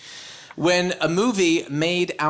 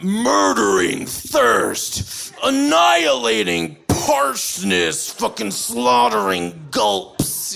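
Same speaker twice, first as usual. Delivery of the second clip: slow, booming action-trailer shout; over-the-top ad slogan; 2 semitones higher, slower than usual. Retold or imitated. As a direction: roar it like an extreme energy drink ad. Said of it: "They say things like".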